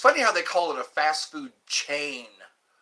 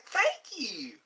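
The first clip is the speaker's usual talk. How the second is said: high southern thank you; high sweet southern accent; 12 semitones higher than usual. Pretend. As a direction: keep the southern accent going. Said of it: "There's my southern accent".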